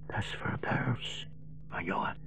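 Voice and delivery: the voice running out; dying man whispering to the boy; fading whisper. Acted. Dying rabbi's last vision.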